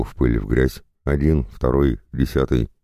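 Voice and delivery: usual speaking voice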